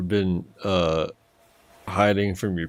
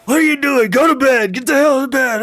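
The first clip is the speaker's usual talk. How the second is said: yelling parent; 5 dB louder, faster than usual. Retold or imitated.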